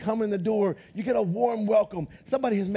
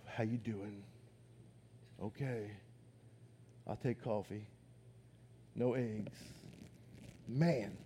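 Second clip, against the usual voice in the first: low, soft, lifeless monotone mumble; bored boring person; 13 semitones lower, 18 dB quieter than usual. Retold or imitated. A dull person answering a lively friend, low bored drone. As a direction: sound like you can barely be bothered to talk. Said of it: "And they're like".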